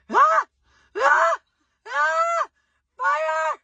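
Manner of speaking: high, loud bursts of panic; re-enacted screaming. Woman retelling flames on her stove, re-enacted screaming. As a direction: scream in short separate bursts, acting out alarm. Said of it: "and I literally was screaming".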